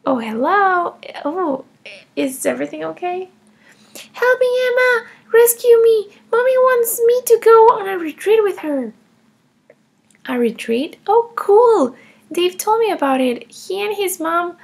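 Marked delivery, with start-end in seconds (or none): 4.1-8.9 put-on higher, louder child pleading; mock plea for rescue. Child begging her friend to rescue her from the retreat. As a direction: beg dramatically to be saved.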